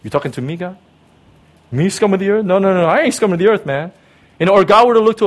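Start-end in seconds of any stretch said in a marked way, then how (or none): 0.0-3.9 higher, louder and fast, bristling at the insult; proud indignant man. Pretend. Prideful person snapping back at God, offended and loud. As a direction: scoff it off like a cocky tough guy. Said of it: "what a prideful person would say".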